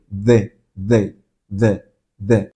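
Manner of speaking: "D" as short, low, separate "duh" beats; voiced D sound. Shown as a demonstration. Teacher lets students feel the voicing, "D" in short low pulses. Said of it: "tiene sonido".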